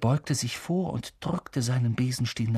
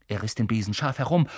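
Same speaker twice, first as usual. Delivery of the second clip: plain reading voice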